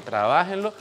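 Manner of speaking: usual speaking voice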